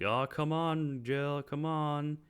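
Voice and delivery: a man half-heartedly pleading with Jill; teasing man. Retold or imitated. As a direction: drag it out like coaxing a friend.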